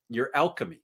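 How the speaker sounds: ordinary talk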